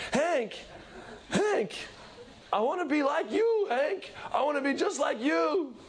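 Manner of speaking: high-pitched, gushing, fan's cries; adoring fan screaming. Retold or imitated. Fan screaming his love at Hank, high and frantic. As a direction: gush like a starstruck fan. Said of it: "He's, like, screaming for Hank".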